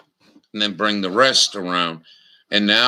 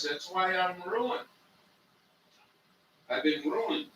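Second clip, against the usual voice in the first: soft; 9 semitones higher, 9 dB quieter than usual. Real, not acted. Quiet high rant on toothpaste.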